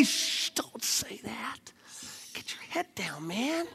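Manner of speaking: slow, hushed whisper; timid youth-group friend whispering. Acted. Timid Christians hushing.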